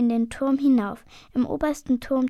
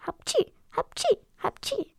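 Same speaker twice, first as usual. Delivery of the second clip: high comic sneezes; sneezing; 12 semitones higher than usual. A narrator's reading. Die Gäste niesen im Gasthaus, lautmalerisch hoch. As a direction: sneeze three times, cartoon-style. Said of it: "müssen sie alle niesen".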